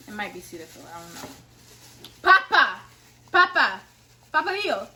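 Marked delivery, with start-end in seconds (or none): 2.2-4.9 loud, high call across the house. Real, not acted. Calling her father to show the gift, loud call. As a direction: shout for him to come.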